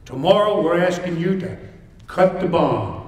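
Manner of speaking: ordinary talk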